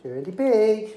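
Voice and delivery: usual speaking voice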